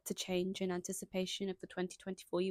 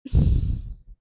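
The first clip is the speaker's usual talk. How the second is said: loud sigh; 14 dB louder than usual. Real, not acted.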